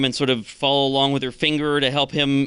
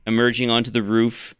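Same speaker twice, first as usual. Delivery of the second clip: ordinary delivery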